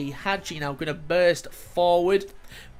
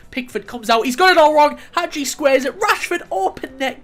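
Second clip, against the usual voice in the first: much louder and higher, building to open net; excited yell; 8 semitones higher, 7 dB louder than usual. Real, not acted. Gamer calling the keeper's blunder, yelling. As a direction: yell as the keeper gifts the goal.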